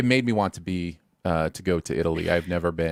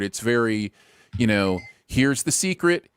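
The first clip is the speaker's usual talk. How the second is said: ordinary talk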